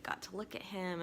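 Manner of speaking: ordinary talk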